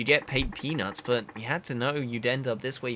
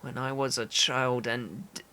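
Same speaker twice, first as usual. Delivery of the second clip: weak, halting, solemn; frail but dignified game character; cling to pride with little strength; starving proud man. Acted.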